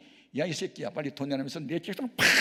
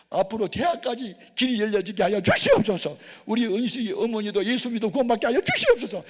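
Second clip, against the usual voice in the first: much higher, louder prayer chant; loud fervent prayer; 8 semitones higher, 9 dB louder than usual. Retold or imitated. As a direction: pour out the blessing at full force. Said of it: "눈을 뜨고 기도했어요".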